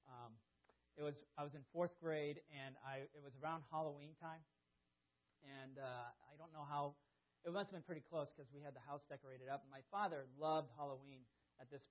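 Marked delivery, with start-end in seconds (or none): none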